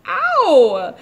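loud, high pained yelp. Acted. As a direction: cry out as if just hit. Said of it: "The tiger yelled".

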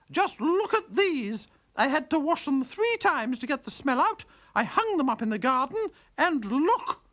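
high, loud, outraged complaint; wife outraged at her husband over spoiled washing; indignant wife. Acted. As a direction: shove the ruined pyjamas at him. Wife complains about the pyjamas.

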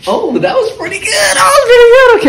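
shrieking laugh, high pitched. Real, not acted. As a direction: crack up at yourself. Laughing at his freestyle.

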